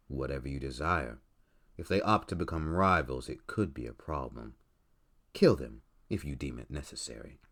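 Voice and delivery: low, quiet, menacing employer; cold boss voice. Acted. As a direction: speak low and coldly, like a crime boss.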